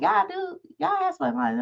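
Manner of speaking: high-pitched mock excited believer; imitating the guy. Mocking a friend who says God speaks to him, high and eager. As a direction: squeak it out like an eager convert. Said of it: "He like".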